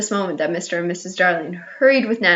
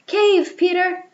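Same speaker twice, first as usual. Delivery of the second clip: much higher calling out; warning call; 10 semitones higher, slower than usual. A narrator's reading. Smallest star warning Peter, high call. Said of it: "called out".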